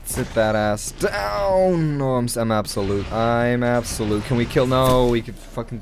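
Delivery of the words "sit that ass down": slow, higher, stretched-out gloat; drawn-out taunt. Real, not acted. Gamer taunting an opponent in the match, drawn-out gloating.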